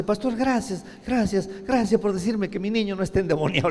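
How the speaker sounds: grateful mother. Retold or imitated. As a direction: pour out thanks like a relieved mom.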